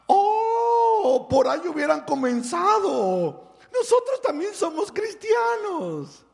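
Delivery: high, loud delighted cry of recognition; thrilled squeal of a woman who found fellow believers; excited exclamation. Retold or imitated. Excited woman discovers shared faith.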